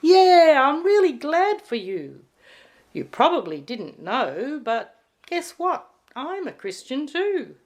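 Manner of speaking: high, eager bunny voice; cheerful Bunny. A narrator's reading. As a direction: bubble over with good news. Bunny cheerfully reveals his faith.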